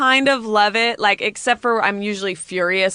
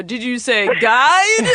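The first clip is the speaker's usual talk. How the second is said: mock confused question; 3 semitones higher, 4 dB louder, slower than usual. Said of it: "you go".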